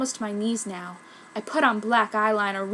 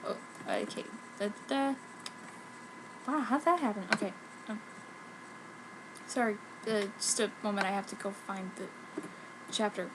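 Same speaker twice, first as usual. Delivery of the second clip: soft muttered off-mic aside; quiet aside; 12 dB quieter, faster than usual. Real, not acted. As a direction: mumble it while searching. Quiet aside, losing place.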